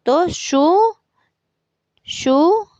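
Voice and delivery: शु called out high and loud for the class; syllable demo. Shown as a demonstration. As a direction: announce the joined syllable शु clearly and loudly. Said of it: "तो श, उ". (शु को ऊँचे और ज़ोर से बोलिए)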